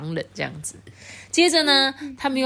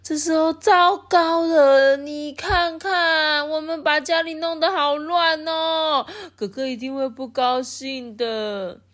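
high, slow fretful piglet voice; high, drawn-out worried piglet voice; worried little pigs; 11 semitones higher, 3 dB louder, slower than usual. Acted. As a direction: fret slowly like a scared little pig. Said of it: "他们就说".

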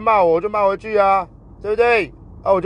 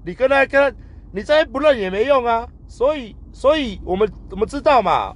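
heated retelling; 7 semitones higher than usual. Retold or imitated. Retelling court pressure, heated.

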